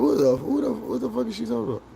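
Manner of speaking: high, fast baffled sputter; high-pitched disbelief, rushing; confused reaction. Retold or imitated. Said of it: "I'm like".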